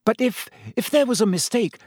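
high, halting, stammered plea; timid schoolgirl daring to object; nervous protest. A narrator's reading. Hermione nervously protests to Snape.

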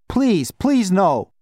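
high, pleading croak; croaked plea. A narrator's reading. Boy begging not to go to the orphanage, croaking. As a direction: beg in a croak, pitch jumping up. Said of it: "he croaked".

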